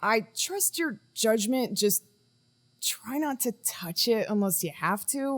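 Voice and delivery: ordinary talk, nothing marked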